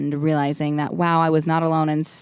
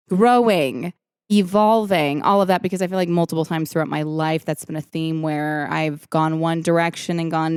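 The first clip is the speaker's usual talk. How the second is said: ordinary talk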